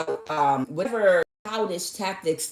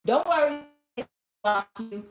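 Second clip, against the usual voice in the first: higher placating coach voice; lightly hammed-up soothing; mock reassurance; 5 semitones higher, faster than usual. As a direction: play a coach offering false comfort. Mimics coaches' empty reassurances.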